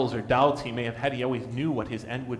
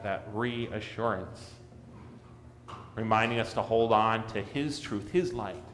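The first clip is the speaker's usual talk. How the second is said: normal talking voice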